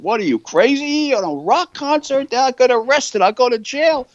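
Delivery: a retold yelp of panic at a crazy idea; panicked outburst. Stas hears the rock concert idea, imitated panicked and high. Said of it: "Well, he went nuts".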